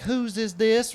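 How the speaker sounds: high, loud outraged echo; mock outraged. Quoting the upset lady, mock outraged. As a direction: repeat it as if scandalized. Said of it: "She's like, real upset".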